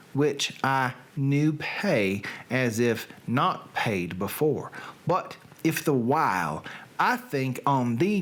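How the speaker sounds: put-on slow, solemn Shakespeare sonnet in a Southern accent; Southern accent reading. Reading a sonnet to his live stream viewers, slow Southern accent. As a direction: recite it slowly like a Southern poetry reading. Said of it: "Reading Things in a Southern Accent".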